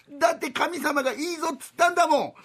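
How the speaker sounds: put-on high, sulky kid excuse; whiny child. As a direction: protest like a pouting boy. Boy protests to mother.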